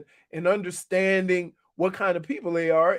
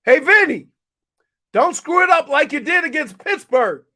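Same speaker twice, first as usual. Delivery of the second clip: loud, higher yelled jab; Belichick yell; 7 semitones higher, 8 dB louder than usual. Retold or imitated. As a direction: yell it across the field. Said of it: "Bill yells".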